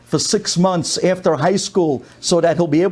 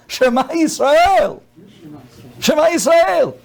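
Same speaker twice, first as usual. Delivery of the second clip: higher, slow, half-laughing gasp of Shema Yisrael; mock shocked exclamation; 6 semitones higher, slower than usual.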